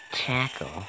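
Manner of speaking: ordinary talk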